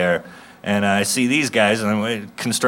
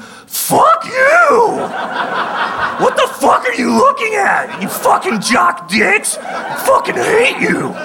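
nerd rage screaming. Retold or imitated. Comic yelling at two jocks outside the gym, screamed in high-pitched fury. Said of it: "I just fucking flipped out".

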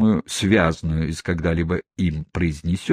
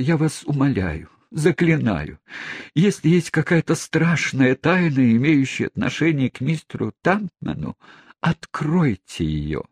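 pleading; 7 semitones higher than usual. Acted. Aunt begs for the secret.

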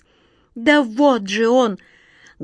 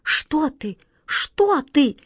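pleading; 4 semitones higher, slower than usual. A narrator's reading. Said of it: "взмолилась Катя".

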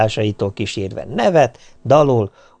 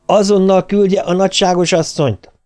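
raised, high demand into the phone; bellowed order; 9 semitones higher, 3 dB louder than usual. A narrator's reading. Master orders the maid to fetch his wife, bellowing. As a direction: bellow it as an order, pitch pushed up. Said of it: "Az üvöltésről felismert".

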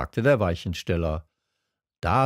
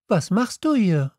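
put-on high, curious young questioner; Little Prince; 10 semitones higher than usual. A narrator's reading. Prince questions the switchman, in a high voice. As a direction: ask it high and light. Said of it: "sagte der kleine Prinz".

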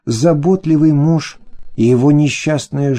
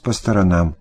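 usual speaking voice

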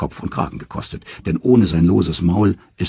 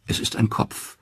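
ordinary delivery, nothing marked

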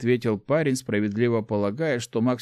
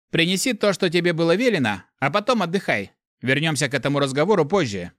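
curt cutoff; 7 semitones higher than usual. A narrator's reading. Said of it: "отрезал".

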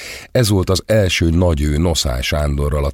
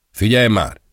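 sharp, raised "Figyelj már!"; irritated snap; 5 semitones higher than usual. A narrator's reading. Designer snapping at the blonde model, irritated. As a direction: bite the words off, fed up. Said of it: "csattant fel ingerülten".

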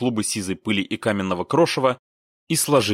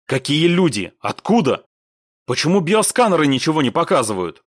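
raised, irritated demand; angry questioning; 6 semitones higher, 5 dB louder than usual. A narrator's reading. Commander rounding on Laptev over scanners, angrily. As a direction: fire questions crossly at a subordinate. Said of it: "зло повернулся к Лаптеву".

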